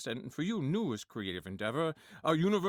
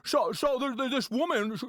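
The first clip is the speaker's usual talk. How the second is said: Jimmy Stewart impression; 7 semitones higher, 4 dB louder than usual. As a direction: stutter through it like Jimmy Stewart, pitched up. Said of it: "Let's listen to Jimmy Stewart already talking".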